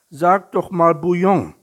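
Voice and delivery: high, loud suggestion; eager prompting. A narrator's reading. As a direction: prompt the little tiger brightly and loudly. Bear prompts tiger's choice of dish. (laut und auffordernd vorgeschlagen)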